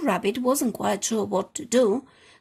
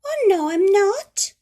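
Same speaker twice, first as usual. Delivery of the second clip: high, louder little rabbit voice; cheerful high little-rabbit voice; 9 semitones higher, 9 dB louder than usual. Acted. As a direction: pipe up high like a small rabbit. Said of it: "said the little voice".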